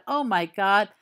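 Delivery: plain reading voice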